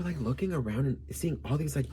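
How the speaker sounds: ordinary delivery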